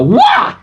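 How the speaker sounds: sudden high yelp of a fall; tripping yelp. Acted. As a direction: yelp as you trip. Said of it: "Flailing his arms wildly".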